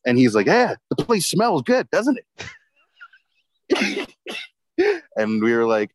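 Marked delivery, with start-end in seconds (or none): 0.5-5.1 high, proud sing-song boast; proud mock boast. Roommate bragging to housemates, high proud voice. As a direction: crow about the clean oven, pitched up.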